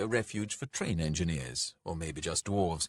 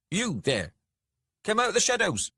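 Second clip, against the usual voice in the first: high, loud guard's shout; shouted challenge; 14 semitones higher, 6 dB louder than usual. A narrator's reading. Dwarf guard ordering intruders out of the shadows, shouting. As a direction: call out to flush them from hiding.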